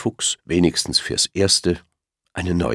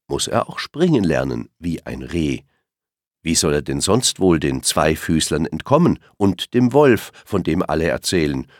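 plain reading voice

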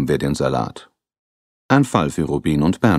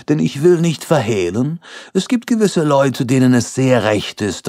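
higher, refined and measured noble lady; dignified princess; 6 semitones higher than usual. Acted. Princess addressing the detectives, lightly voiced aristocratic lady. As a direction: speak with gracious aristocratic composure.

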